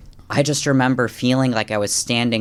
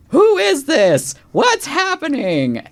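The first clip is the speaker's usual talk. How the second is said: much higher, louder, slow panicked question; alarmed disbelief; 7 dB louder, slower than usual. Retold or imitated. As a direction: cry out in bewildered shock. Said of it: "and I'm thinking".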